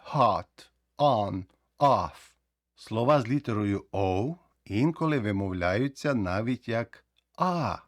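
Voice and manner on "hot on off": Hot, on, off with an open vowel between o and a. Shown as a demonstration. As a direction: say hot like a blend of o and a. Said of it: "Щелепу просто опускаєте".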